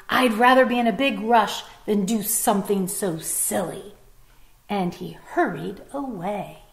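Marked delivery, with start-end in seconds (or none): 0.1-3.9 louder, scornful fox; mock the silly yoga idea; scoffing fox.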